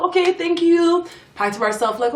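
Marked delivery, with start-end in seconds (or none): 0.0-1.1 high, slow, overly sweet thank-you; fake polite thanks. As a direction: sweet-talk your way out the door. Thanking the front girl.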